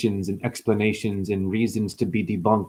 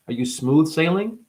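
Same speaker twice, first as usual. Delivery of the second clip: ordinary delivery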